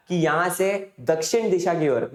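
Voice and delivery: ordinary delivery, nothing marked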